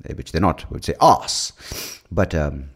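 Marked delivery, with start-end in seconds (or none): 1.0-1.5 British arse. Shown as a demonstration. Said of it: "Or if the British were here".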